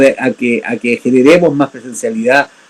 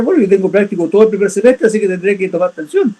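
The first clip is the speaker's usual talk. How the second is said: higher-pitched, quick student reasoning; lightly mimicked student, pitched up; imitating students' thinking; 6 semitones higher than usual. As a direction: voice the kids' thoughts, higher and hurried. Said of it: "los chiquillos empezaron a pensar".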